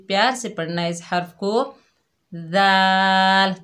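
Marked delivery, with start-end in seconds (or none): 2.3-3.5 दाल very slow, thin, lips spread; long, fine दाल; दाल stretched with spread lips; thin dal. Shown as a demonstration. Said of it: "ताकि इसमें सख्ती पैदा ना हो".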